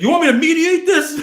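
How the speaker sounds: high, loud, laughing mock offer; a laughing, loud send-up of the mediator. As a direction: ham up the dealer's offer to mediate. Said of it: "And he's like".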